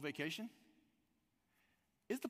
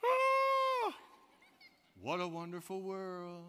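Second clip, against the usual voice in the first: satisfied alligator; 3 semitones higher, 5 dB louder, much slower than usual. Pretend. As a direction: play the gator savouring his meal. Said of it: "you look at his face, and it's like".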